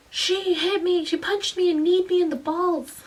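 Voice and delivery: high-pitched mock wailing; howling boy. Grade seven boy tattling to the supervision aide, mock howling. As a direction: imitate a hurt kid howling out his complaint. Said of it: "screaming and howling from this grade seven".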